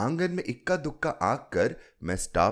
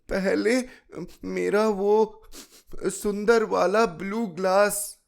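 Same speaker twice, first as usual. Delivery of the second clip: put-on high, pleading child demanding her glass; little girl's voice; 11 semitones higher, 5 dB louder, slower than usual. A narrator's reading. Chilki insisting to her grandmother, high child voice. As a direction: voice the six-year-old, pitched high.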